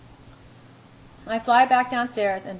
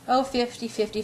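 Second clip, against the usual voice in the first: ordinary delivery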